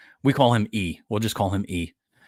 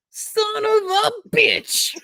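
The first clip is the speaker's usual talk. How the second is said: put-on very high, drawn-out kid squeal; imitated little boy cursing; 4 dB louder, much slower than usual. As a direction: squeeze out the curse like an exasperated kid. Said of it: "and he'll go".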